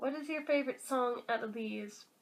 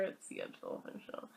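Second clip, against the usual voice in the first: breathy whispered aside; 10 dB quieter, faster than usual. Real, not acted. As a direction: whisper it like an obvious secret. Whispered sarcastic answer.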